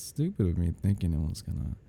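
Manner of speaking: ordinary delivery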